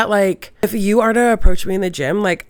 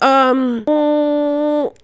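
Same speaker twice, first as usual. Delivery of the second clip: high, slow, hesitant excuse with hums; nervously squirming out of the invitation; awkward dodge; 8 semitones higher, 5 dB louder, much slower than usual. Retold or imitated. And I was like.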